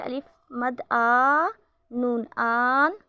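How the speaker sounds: slow, with आ and आन long and stretched; drawn-out madd. Shown as a demonstration. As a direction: pull the vowel आ out long. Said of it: "मद को थोड़ी सी खींच कर पढ़ते हैं".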